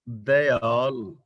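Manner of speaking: ਦਇਆਲ said slowly as "da-yaal", short a after d; ਦਇਆਲ drawn out with a clear "da" first; correct pronunciation. Shown as a demonstration. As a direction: stretch ਦਇਆਲ into da-i-aal, very slowly. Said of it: "ਇਸ ਲਈ ਇਹਦਾ ਸ਼ੁੱਧ ਉਚਾਰਨ ਹੋਏਗਾ".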